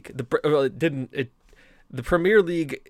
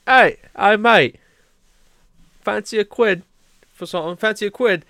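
lightly voiced drunk Brit begging a quid; drunk English hooligan; 6 semitones higher, 6 dB louder than usual. On a London street, hooligan pesters the boys in a mock English drunk voice. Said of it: "accosted by a drunk English ... hooligan".

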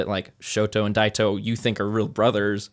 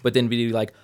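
normal talking voice

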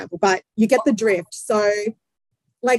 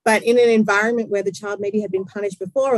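ordinary delivery

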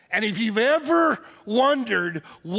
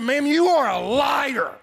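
slow, loud, higher shout; furious accusation; 5 semitones higher, 4 dB louder, slower than usual. Retold or imitated. Pastor calling the woman a liar, slow loud shout. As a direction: spit out the accusation. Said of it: "I called her a liar to her face".

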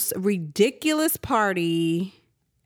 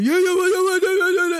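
loud, higher rowdy yeah-yeahs; party guys yelling; 10 semitones higher, 5 dB louder than usual. Retold or imitated. Mocking the neighbors' party guests, loud higher whoops. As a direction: mimic drunk partiers whooping. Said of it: "all that stuff that I heard last night".

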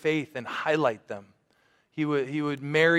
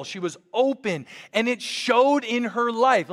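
impassioned preaching; 6 semitones higher, 5 dB louder than usual. Acted. Pastor to congregation praising Jessica, impassioned higher louder preaching. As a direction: preach it with building fervour.

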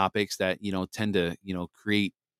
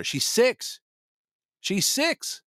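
incredulous high; 14 semitones higher, much slower than usual. Real, not acted. Exasperated about six-year-old daughter.

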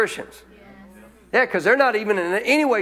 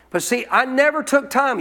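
normal talking voice

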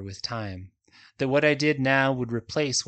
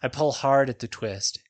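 ordinary delivery, nothing marked

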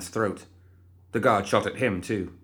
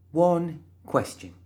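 high, slow sing-song threat; slow, high, playful threat; sweet menace; 9 semitones higher, much slower than usual. Acted. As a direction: tease each word out. Finger in the man's face.

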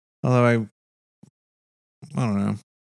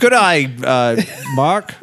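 put-on Australian accent; slower than usual. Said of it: "do Australian accent".

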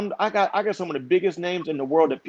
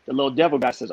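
normal talking voice

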